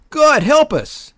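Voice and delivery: high, loud, drawn-out exclamation; bellowing. A narrator's reading. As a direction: bellow it to the soldier beside you. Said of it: "he bellowed to the man next to him".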